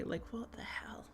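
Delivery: hushed aside; whisper. Real, not acted. Streamer whispering to chat about nearing one K. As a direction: breathe it under your breath.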